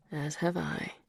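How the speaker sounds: soft low whisper. A narrator's reading. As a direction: breathe it close and low. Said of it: "he whispered".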